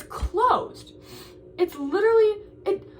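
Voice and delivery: the words break as she starts to cry. Real, not acted. Venting to viewers as tears break through, real crying. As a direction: voice cracking high and loud into real tears. Crying over closed dining hall.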